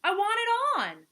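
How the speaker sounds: high, loud, insistent whine; whining. A narrator's reading. Turtle pesters Vulture to share, high loud whine. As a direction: push it high like a whiny demand. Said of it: "whined Turtle".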